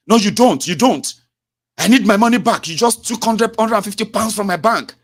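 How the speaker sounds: high, loud furious customer demanding to cancel; angry customer. Retold or imitated.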